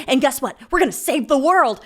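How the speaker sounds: high, loud and building; manic exclamation. Acted. Crazed roommate announcing his plan to her, manic outburst. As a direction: proclaim a grand mission, rising toward a scream.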